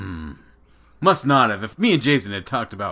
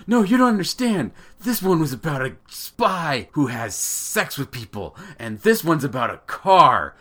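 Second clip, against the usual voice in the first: hammed-up dad insisting, starting high and loud; mock-urgent explaining, punching key words; exasperated excitement; 5 semitones higher, 4 dB louder than usual. Dad trying to make his son understand, exasperated and excited.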